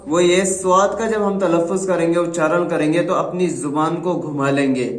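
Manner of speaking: normal talking voice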